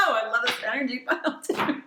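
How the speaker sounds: giggly high delighted squeal; delighted laughing. Real, not acted. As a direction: laugh with pure joy.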